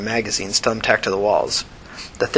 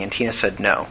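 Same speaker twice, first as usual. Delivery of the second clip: ordinary delivery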